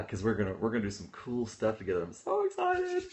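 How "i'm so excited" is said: very high, slow squeal of delight; thrilled squeal. Real, not acted. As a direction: squeal it out, bursting with joy.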